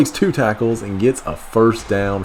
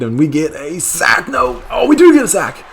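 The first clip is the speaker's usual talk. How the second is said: excited disbelief; 10 semitones higher, 7 dB louder than usual. Real, not acted. Reacting live to a sack.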